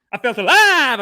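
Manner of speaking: high, loud cry of elation; exultant shout. Acted. Comic recalling stand-up shows, exultant shouting. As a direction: belt it out, high on "alive".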